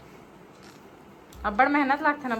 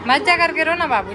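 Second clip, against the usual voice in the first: pleading call; 7 semitones higher, 10 dB louder than usual. Real, not acted. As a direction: beg the little one not to go. Begging child not to leave.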